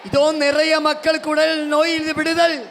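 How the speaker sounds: high, loud revival shouting; full-force preaching. Real, not acted. Preacher urging congregation to praise aloud, shouted at full force. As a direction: rouse the crowd with ringing, high-pitched cries.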